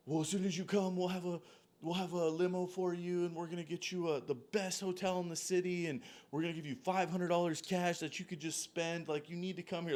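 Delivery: mimicking a producer bribing him to come, lightly voiced; producer sweet-talk. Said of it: "they were like".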